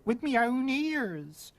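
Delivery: high, slow whine; whining. A narrator's reading. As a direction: whine to be believed. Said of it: "whined the cook".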